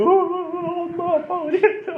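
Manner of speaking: father imitates his lost son wailing for mom and dad; imitating a crying child. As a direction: wail like a lost little boy calling his parents. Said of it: "막 울면서 다니더라고요".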